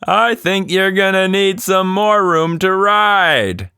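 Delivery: a cheerful father shouting from the window; father calling down from upstairs; father yelling. Acted. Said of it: "Her father waved and yelled".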